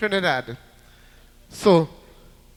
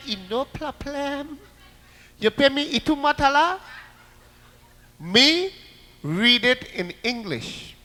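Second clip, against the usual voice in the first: calm sly haggling, mock Chinese-Trinidadian; Chinese-Trinidadian accent; 5 semitones higher than usual. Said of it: "they talk in a very funny Trinidad accent".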